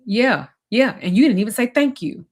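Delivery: imitating a scornful, aggrieved reply; mock sarcastic partner. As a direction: brush her off with scorn. Retold quarrel with Damon.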